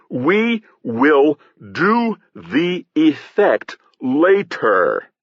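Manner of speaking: slow, high-pitched holler, word by word; bellowing. A narrator's reading. Satirist calling from the foot of the stage, bellowing. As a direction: call it out slowly through cupped hands. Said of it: "cupped his hands around his mouth, and bellowed".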